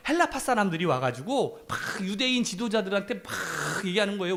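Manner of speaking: usual speaking voice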